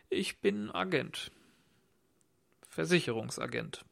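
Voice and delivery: embarrassed. A narrator's reading. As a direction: confess it hesitantly, embarrassed. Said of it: "hüstelte und rief verlegen". (verlegen und leiser)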